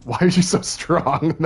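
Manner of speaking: high, loud, slow question into laughter; laughing disbelief. Real, not acted. Player marvelling at upgraded strength, laughing incredulously. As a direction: draw it out loudly, then burst out laughing. Laughing at overpowered game character.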